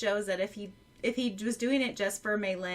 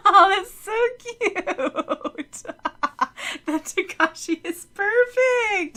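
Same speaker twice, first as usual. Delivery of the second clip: laughing with delight; 11 semitones higher, 10 dB louder, much slower than usual. Real, not acted. Delighted by fan art shown.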